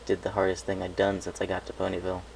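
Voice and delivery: ordinary delivery, nothing marked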